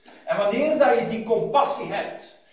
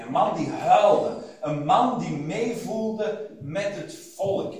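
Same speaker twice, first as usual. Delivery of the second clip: slow, weighty preaching; slow emphasis; slower than usual. Acted. Preacher to congregation about Jesus' compassion, slow and weighty. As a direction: draw each word out.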